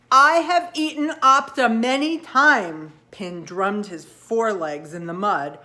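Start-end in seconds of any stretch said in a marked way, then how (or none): 0.1-2.9 loud, stubborn insistence. Acted. Pin insists to the doubting frog, loud and stubborn. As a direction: plant your feet and insist louder.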